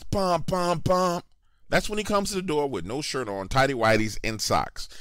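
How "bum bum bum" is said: three higher, evenly spaced horn honks; car horn imitation. Telling how they blasted the horn outside, horn imitation. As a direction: honk out "bum" like a car horn. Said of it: "I hit that horn three times".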